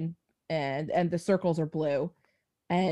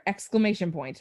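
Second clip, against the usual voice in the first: ordinary talk, nothing marked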